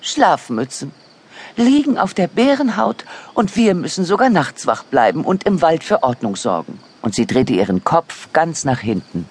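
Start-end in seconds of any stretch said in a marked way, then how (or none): 0.0-6.8 grumbling owl, pitched up then falling; high, indignant storybook owl; disapproving owl. A narrator's reading. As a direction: scold the sleepyheads as a put-out owl. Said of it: "dass die Eule Bären nicht leiden konnte".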